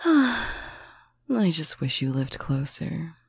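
ordinary talk

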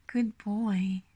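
usual speaking voice

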